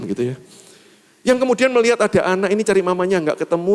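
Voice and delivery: usual speaking voice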